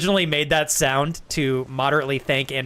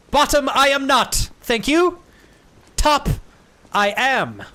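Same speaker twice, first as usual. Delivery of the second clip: mock proclamation; 11 semitones higher, 6 dB louder, slower than usual.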